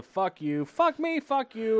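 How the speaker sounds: high-pitched mock squabble; retold back-and-forth fight. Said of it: "they're fighting back and forth".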